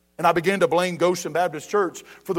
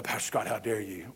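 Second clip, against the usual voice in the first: mock indignant whisper; 11 semitones lower, 11 dB quieter than usual.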